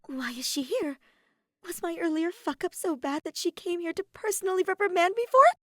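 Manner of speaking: high, frantic worrying; spiralling into a squealed freak-out; panicked thoughts. A narrator's reading. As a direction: build from anxious questioning to a loud, high panic. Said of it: "so many thoughts running through her mind".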